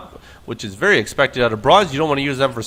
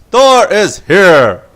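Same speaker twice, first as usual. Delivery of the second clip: slow, much louder and higher mock mech roar; hammed-up Thor voice; 6 semitones higher, 11 dB louder, much slower than usual. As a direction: belt it out like an over-the-top robot. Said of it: "I don't know how to do the Thor voice".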